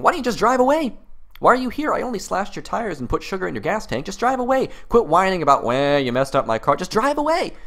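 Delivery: high, sneering mock voice; mock smug tire slasher. The tire slasher sneering at his victim, mocking. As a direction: play the vandal telling his victim to get over it. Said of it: "and then saying".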